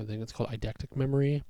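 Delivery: ordinary talk, nothing marked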